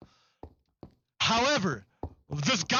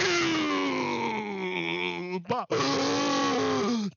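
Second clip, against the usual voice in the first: hammed-up drawn-out gasp; 4 semitones higher than usual. Mock time-travel effect.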